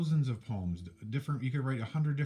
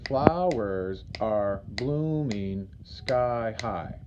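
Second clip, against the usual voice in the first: syllable by syllable; 5 dB louder, much slower than usual. Shown as a demonstration. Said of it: "Okay, that has seven".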